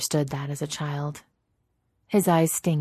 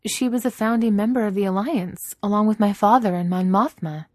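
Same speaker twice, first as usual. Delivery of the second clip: higher, louder woman's character voice; Leia, taken aback; 6 semitones higher, 6 dB louder than usual. Acted. Leia learning who her mother was, surprised. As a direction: speak up higher, amazed at the news.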